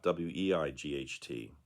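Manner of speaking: spelling it out letter by letter; spelled out. Shown as a demonstration. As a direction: spell each letter slowly and distinctly. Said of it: "That's weight spelled".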